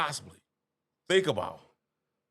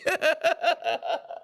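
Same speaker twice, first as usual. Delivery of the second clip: high laugh; 10 semitones higher than usual. Real, not acted. Cracking up again.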